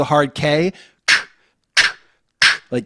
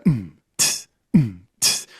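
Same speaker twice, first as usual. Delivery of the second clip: low hum then hiss; beatbox kick and hi-hat; 4 semitones lower, slower than usual. Shown as a demonstration. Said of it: "And then you get the".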